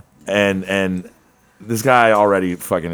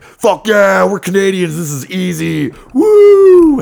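high, loud whooping bros; Canadian dudes boasting about the bike tour; rowdy party cheer; 11 semitones higher, 8 dB louder, slower than usual. Retold or imitated. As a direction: cheer it hammered.

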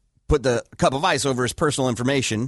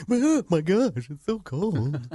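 mock whiny; 4 semitones higher, slower than usual. Mocking tough fighters' reaction.

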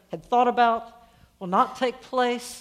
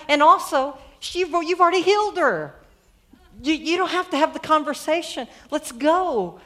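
higher, louder impatient protest; annoyed; 6 semitones higher, 6 dB louder than usual. Acted. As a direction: urge them to move, exasperated. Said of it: "may be a little bit annoyed".

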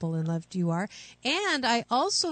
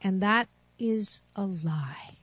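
whispering; slower than usual. Real, not acted. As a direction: drop to a hushed whisper. Coach states a hard truth.

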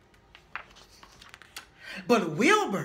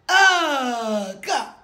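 disgusted groan; 6 dB louder than usual. Acted. Mole rats disgusted.

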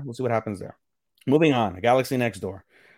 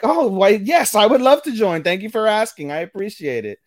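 high, louder, gushing acted reply; delighted acceptance; 10 semitones higher, 6 dB louder than usual. Retelling an anime heroine invited to join a club, delighted. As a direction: act out an overjoyed, polite yes.

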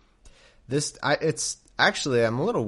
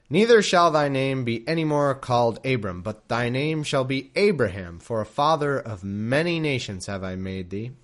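louder, formal King James reading; scripture reading; 4 dB louder than usual. A narrator's reading. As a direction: read it like solemn scripture. Reads scripture aloud.

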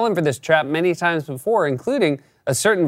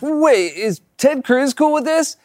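higher, drawn-out mock worry; mock concerned question; 11 semitones higher, slower than usual. A voter checking on Ted Cruz, mock concerned. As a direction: ask it slowly in a put-on higher pitch.

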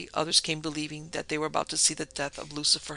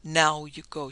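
ordinary delivery

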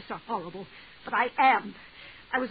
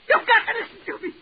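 much higher and louder, pleading cry; desperate plea; 12 semitones higher, 7 dB louder than usual. Acted. Woman begging the clerk for pity, desperate cry. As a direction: beg him at the top of her voice.

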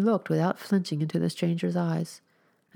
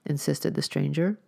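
usual speaking voice

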